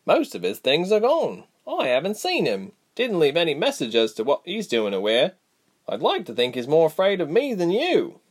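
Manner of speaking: a sharper voice, irritated but caring; stern Reshma. A narrator's reading. Reshma's dialogue.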